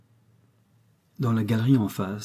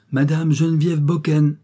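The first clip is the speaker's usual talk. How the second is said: announcing a name; 6 semitones higher, 5 dB louder than usual. A narrator's reading. Annonce officielle du nom.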